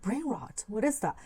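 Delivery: ordinary talk, nothing marked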